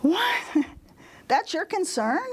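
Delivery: high-pitched mock disbelief, questions rising sharply; disbelieving, drawn-up "what?"; incredulous. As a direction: imitate a stunned canvasser, voice shooting up. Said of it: "stared at me like".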